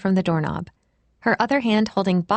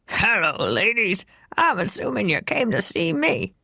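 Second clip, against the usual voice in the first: put-on rough old man voice. A narrator's reading. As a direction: tease the ladies like an old smoker. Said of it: "spoke in that rough voice".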